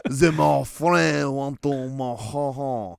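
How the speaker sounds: hammy mock French with laughs; French accent. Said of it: "we're doing perfect French accents right now".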